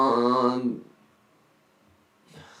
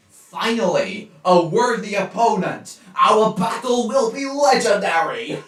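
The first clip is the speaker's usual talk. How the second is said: put-on Irish accent; 9 semitones higher, 3 dB louder than usual. Mocking a cartoon pig rival in a mock Irish accent. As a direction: lean into a stage Irish accent, drawing out Finally. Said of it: "let me find my Irish accent".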